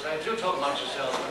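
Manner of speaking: usual speaking voice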